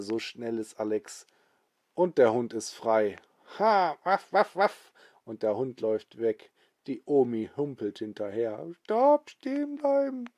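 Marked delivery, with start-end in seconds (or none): 3.6-4.9 playful barking. Acted. The freed dog barks. 8.8-10.2 put-on much higher, thin old lady call; old woman calling. Grandma calling her runaway dog to stop, high old voice.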